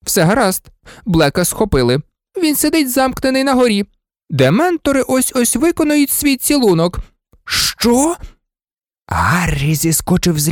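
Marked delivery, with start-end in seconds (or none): 0.0-7.0 high-pitched, fussy reassurance; calm the patient in a high nurse's voice; lightly voiced matron, trying to soothe; soothing nurse. A narrator's reading. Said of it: "почала заспокоювати його мадам Помфрі". 7.4-8.1 high-pitched, startled exclamation; sudden high yelp of shock; shocked cry. A narrator's reading. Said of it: "його крик почули в коридорі".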